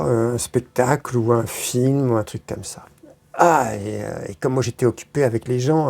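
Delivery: ordinary talk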